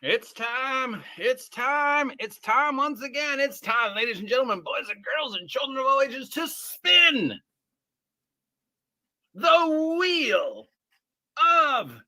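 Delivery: booming high ringmaster announcement. Acted. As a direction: step right up, circus barker style. Game show intro announcement.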